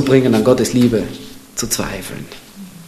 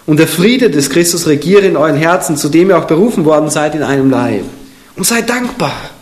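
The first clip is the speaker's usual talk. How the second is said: solemn, raised reading of a Bible verse; scripture reading; 5 semitones higher, 3 dB louder than usual. Acted. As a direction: proclaim the verse to the congregation. Reads scripture aloud.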